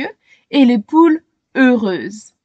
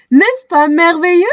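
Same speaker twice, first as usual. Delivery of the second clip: delighted; 6 semitones higher, 5 dB louder than usual. A narrator's reading. Joyful exclamation.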